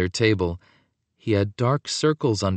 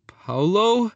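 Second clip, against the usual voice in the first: high, hesitant question; a touch of wobbly uncertainty; mildly wobbly voice; 10 semitones higher than usual. A narrator's reading. Antonio blinking at the flashlight, mildly wobbly voice. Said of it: "he asked in a wobbly voice".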